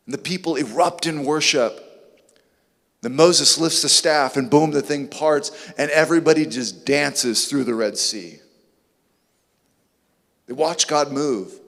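ordinary delivery

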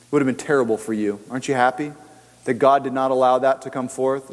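ordinary talk

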